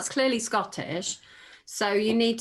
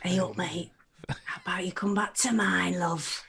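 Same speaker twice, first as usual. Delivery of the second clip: sexy northern voice; 2 semitones lower than usual. Pretend. [name] doing a requested sexy voice for her co-hosts, seductive northern. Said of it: "[name], can you do a sexy voice?".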